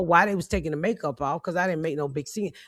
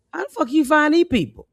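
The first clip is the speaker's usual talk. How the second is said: amazed visitor; 9 semitones higher, 4 dB louder than usual. Retold or imitated.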